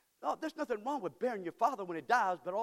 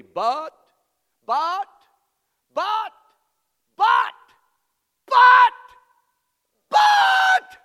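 each but louder and higher, ending in a full shout; building shouted but; 13 semitones higher, 19 dB louder, much slower than usual. Shown as a demonstration. Preacher building the word but to a shout for the congregation. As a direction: hammer the word but harder every time. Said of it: "underline that word, circle that word".

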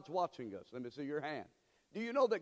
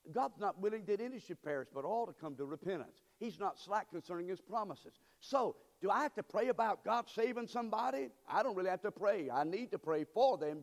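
ordinary talk

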